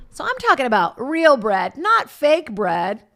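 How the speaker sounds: loud, high stand-up delivery; mock food comic. Food comic opening her bit on bread, hammed-up and loud. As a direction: belt it out like a hack comic.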